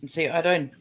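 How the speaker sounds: usual speaking voice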